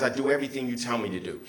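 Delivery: ordinary delivery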